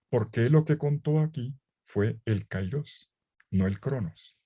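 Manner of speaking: usual speaking voice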